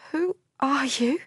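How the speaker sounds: put-on girl in shock recalling her lover's death; dazed girl. A narrator's reading. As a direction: voice the shocked young woman.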